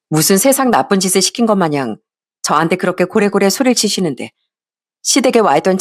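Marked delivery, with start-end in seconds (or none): none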